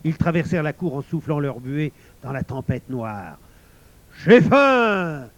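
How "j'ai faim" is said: high, loud wolf howl; howled shout. A narrator's reading. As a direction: howl it out, stretching the last vowel. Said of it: "hurla le loup". (hurlement de loup affamé)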